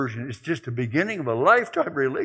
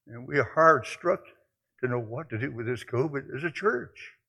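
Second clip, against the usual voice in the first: ordinary delivery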